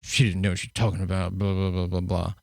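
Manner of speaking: mock eye-rolling dismissal. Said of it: "roll their eyes like".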